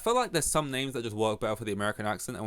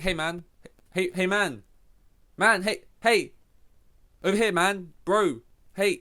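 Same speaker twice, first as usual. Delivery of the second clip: louder, higher mock hailing; calling out greetings; 8 semitones higher, 6 dB louder than usual. Greeting someone whose name he avoids, calling out loudly. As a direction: get his attention without using his name.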